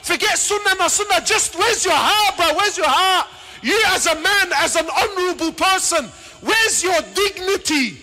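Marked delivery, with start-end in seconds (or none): none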